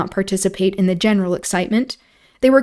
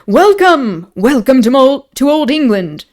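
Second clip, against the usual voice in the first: high, loud, gushing welcome; effusive; 5 semitones higher, 6 dB louder than usual. A narrator's reading. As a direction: throw open your arms in welcome. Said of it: "said Lord Antony effusively".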